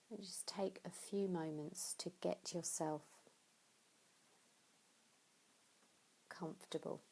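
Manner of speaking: hushed, breathy guiding voice; soft meditation guidance. Acted. Teacher settles listeners before Yoga Nidra, whispered guidance. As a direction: ease them gently into stillness. Guides listener to get comfortable.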